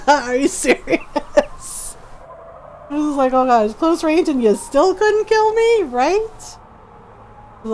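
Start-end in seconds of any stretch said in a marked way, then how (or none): 0.1-2.0 high, laughing disbelief, drawn out; slow words through an imitated burst of laughter. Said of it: "he started laughing". 3.3-6.3 high, loud mock amazement; exaggerated astonished reaction; teasing disbelief. Acted teasing reaction to Drac.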